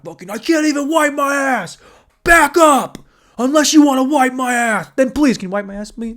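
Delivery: loud, high shouting meathead voice; mock jacked-guy shout. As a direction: yell it like a musclehead who can't move his arms. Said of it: "I'm just gonna walk around like".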